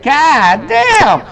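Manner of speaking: high, loud cry of disbelief; shocked god damn. Retold or imitated. To the old man, a high stunned outburst. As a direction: yelp it out in shock.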